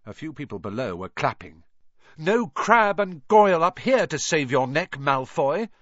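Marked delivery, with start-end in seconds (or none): 2.2-5.7 high, loud challenge; called taunt. A narrator's reading. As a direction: call out a taunt to a rival. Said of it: "Harry called".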